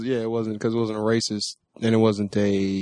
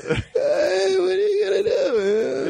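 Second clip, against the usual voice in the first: very high, whiny, laughing; whiny old man, cracking up; Donald Sterling impression; much slower than usual. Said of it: "my Donald Sterling impression".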